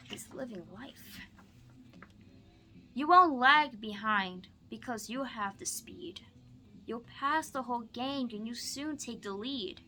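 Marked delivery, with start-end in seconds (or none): 2.9-9.8 lively, expressive storybook read-aloud; bouncy rhyming picture-book voice; reading to children. Acted. Teacher reads Seuss to student.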